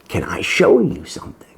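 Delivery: hushed whisper; whispered secret. Retold or imitated. Paul quietly offering to show something, whispered. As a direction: lean in and whisper it. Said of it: "He says".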